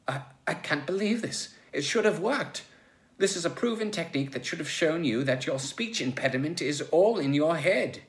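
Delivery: high, stammering dismay; flustered disbelief. Acted. Logue's proven technique fails on Joe, flustered.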